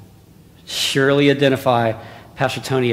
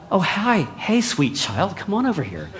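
mock sweet greeting; 5 semitones higher, 5 dB quieter than usual. Imagined kindly neighbor calling a child, mock sweet and soft. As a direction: coo it like a kindly neighbor, tongue in cheek. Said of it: "It wasn't like a".

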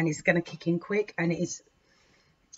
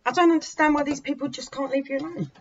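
indignant; 8 semitones higher, 3 dB louder than usual. Real, not acted. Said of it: "so angry for you".

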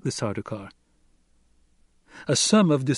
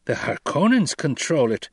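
raised, louder objection; higher-pitched, insistent protest; 5 semitones higher, 5 dB louder than usual. A narrator's reading. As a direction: push back forcefully in protest. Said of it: "Gurney protested".

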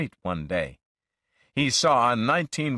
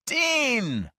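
happy, much higher call of a name; glad cry; 14 semitones higher than usual. A narrator's reading. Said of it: "I cried, smiling".

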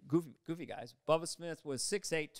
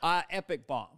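ordinary talk, nothing marked

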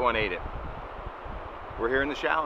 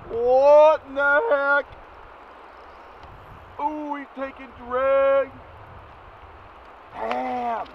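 high, slow astonished exclamations while reeling; stunned excitement as the fish pulls; fighting a fish; 11 semitones higher, much slower than usual. Real, not acted. Landing a big fish.

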